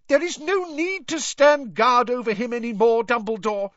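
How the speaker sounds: high, loud shriek. A narrator's reading. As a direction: shriek it in outrage at the headmaster. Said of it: "she shrieked".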